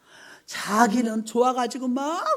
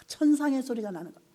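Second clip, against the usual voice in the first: ordinary talk, nothing marked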